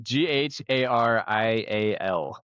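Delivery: slow letter by letter; spelled out slowly. Shown as a demonstration. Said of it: "would you like me to spell it for you".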